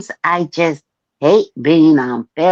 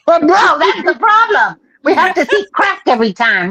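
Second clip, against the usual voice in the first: high laughing oh no; laughing protest; 11 semitones higher, faster than usual. Real, not acted. Laughing at the stream's butt crack. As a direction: squeal it through laughter.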